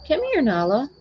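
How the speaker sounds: high, coaxing call to the cat; calling a pet. Real, not acted. Calling Nala the cat over for the viewers, high coaxing call. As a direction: sing out her name to bring her over.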